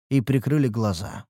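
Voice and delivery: plain reading voice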